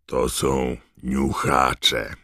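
slow, drawn-out announcement; unhurried creature keeper introducing his animals; Hagrid, very slow and deliberate; lightly voiced Hagrid. A narrator's reading.

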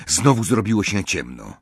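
usual speaking voice

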